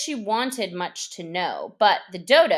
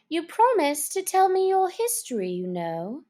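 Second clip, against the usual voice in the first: put-on higher girlish reminder; Alice asking the mouse, higher; lightly voiced Alice; 9 semitones higher than usual. A narrator's reading. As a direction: gently remind him as a young girl.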